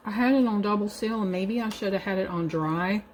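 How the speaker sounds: ordinary talk, nothing marked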